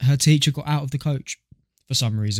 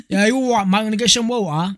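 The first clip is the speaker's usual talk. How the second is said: put-on high-pitched caricature of an English accent; exaggerated mock English accent; 7 semitones higher than usual. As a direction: parody English speech, pitched up. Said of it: "I think English is just disgusting".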